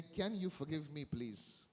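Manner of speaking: soft, low request for forgiveness; humble plea. Shown as a demonstration. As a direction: drop low and soft, ending very quiet on please. Models humbly asking for forgiveness.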